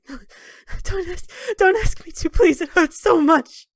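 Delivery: high, strained voice cracking with pain; tortured prisoner begging through pain; pained crying whimper. Acted. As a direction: squeeze each word out through agony. Fenris begging, head strapped down.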